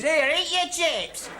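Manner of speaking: high, drawn-out bellow from offstage; mock bellow. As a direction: holler it like a heckler in the wings. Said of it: "a voice bellowing from the wings".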